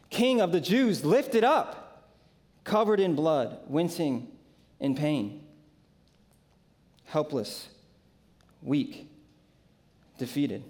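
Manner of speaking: usual speaking voice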